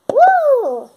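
high loud woo; excited whoop. Acted. Girl whoops while playing in the park. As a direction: cheer out with joy.

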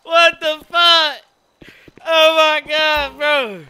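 high, loud, drawn-out disbelief; tearful with laughter. Real, not acted. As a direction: wail it slowly, worn out from laughing. Reacting in hysterics to the chat.

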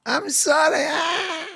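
high, loud faked screams of pain cut with laughter; hammed-up terrified cries and a shouted apology; fake screaming. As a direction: scream and beg like a man being mauled, half laughing. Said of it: "Do your best scream".

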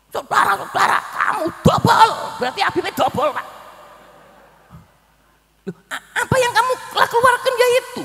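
loud, high ranting imitation; mock shout. Preacher mimicking a habib yelling insults at his audience. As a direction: ham up a ranting cleric.

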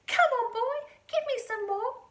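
put-on high, loud old-lady demand; bossy yell. A narrator's reading. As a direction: yell impatiently down at the boy. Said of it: "she yelled".

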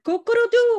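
high loud rooster call; rooster crow. Retold or imitated. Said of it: "the English version of the rooster".

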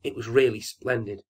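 ordinary delivery, nothing marked

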